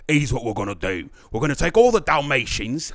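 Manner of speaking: hammed-up stage English villain; exaggerated English accent. Showing the English accent people expect, broad and exaggerated. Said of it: "going to talk like this".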